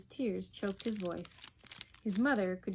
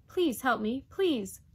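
put-on raised, urgent boyish plea; pleading; 4 semitones higher, 7 dB louder, slower than usual. Boy begs mother for help, pleading. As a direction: beg for help, pressing each please.

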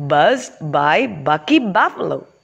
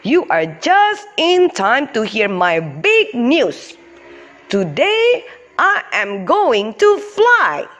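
put-on excited buffalo kid; 6 semitones higher than usual. Bucky character voice, excited.

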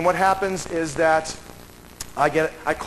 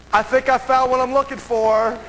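high, raised-voice announcement; retold call across the library, pitched way up; calling out a find; 9 semitones higher, 4 dB louder than usual. Researcher telling the librarian of his find, calling out. Calls out after the discovery.

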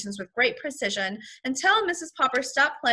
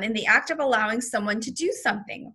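ordinary delivery, nothing marked

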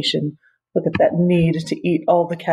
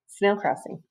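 ordinary delivery, nothing marked

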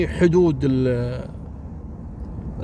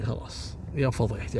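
much softer, near-whispered despair; despairing lament; 4 semitones lower, 10 dB quieter than usual. Retold or imitated. Father lamenting his daughter's scandal, soft despair. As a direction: groan over the shame.

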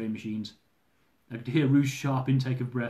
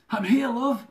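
gasping; 10 semitones higher, 4 dB louder, slower than usual. Acted. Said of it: "I gasped".

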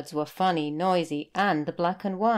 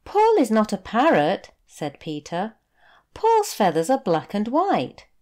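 put-on lightly higher, louder child voice; boy correcting; 4 semitones higher, 6 dB louder than usual. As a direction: patiently set the whale straight.

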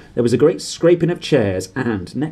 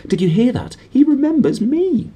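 he's been remembered, squeal with glee; thrilled high cry; 12 semitones higher, 4 dB louder than usual. Acted. Diggle to the crowd, thrilled high squeal. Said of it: "cried Daedalus Diggle".

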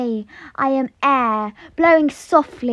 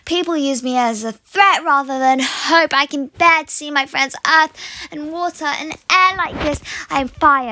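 ordinary delivery

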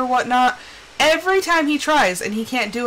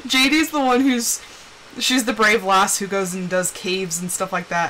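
normal talking voice

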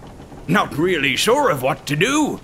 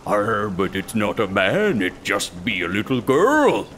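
gruff pirate mate; 7 semitones lower than usual. Acted. Rotten Pete answering the captain, low pirate growl. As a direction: lower pirate voice, a touch gruff.

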